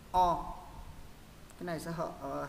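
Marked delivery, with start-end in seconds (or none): 0.1-0.4 short O with jaw dropped, mouth rounded; short open O. Shown as a demonstration. Teacher models the first O sound, short and open. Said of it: "hạ cầm xuống một chút cho miệng nó tròn ra".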